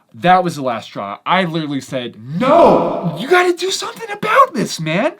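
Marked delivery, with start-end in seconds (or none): 2.2-5.1 loud, high, fast urging; fed-up loud insistence; insistent pleading. Retold or imitated. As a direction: push him hard to act. Last straw, urging the victim.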